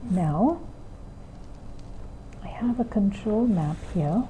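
put-on soft, patient classroom voice to a small child; gentle teacher voice. Shown as a demonstration. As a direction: guide the child calmly through the lesson. Teacher's demo lesson with child.

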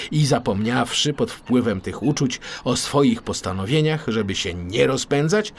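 usual speaking voice

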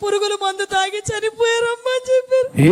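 words breaking up as the sobbing takes over; breaking into tears. Real, not acted. Woman retelling her husband's death to the host, breaking down crying. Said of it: "ఎందుకు ఏడుస్తున్నావు అక్క?".

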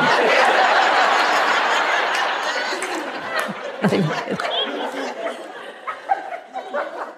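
long unvoiced wheezing laugh; breathy laugh. Real, not acted. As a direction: let out a silent wheeze-laugh. Laughs after murder joke.

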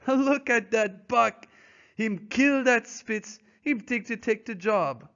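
put-on Francois laughing at Buck's nerve, high delighted accent; gleeful French-Canadian. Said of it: "slapping his thighs gleefully".

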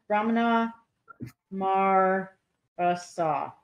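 usual speaking voice

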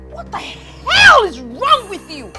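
high, loud indignant tirade; furious outburst. Acted. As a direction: yell it in outrage. Angry outburst at mother-in-law.

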